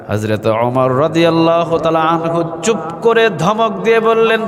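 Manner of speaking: rising to a high sung rebuke; melodic rebuke in preaching. A narrator's reading. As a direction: chant the scolding high, long held vowels. (সুরেলা উঁচু গলায় ধমকের ওয়াজ)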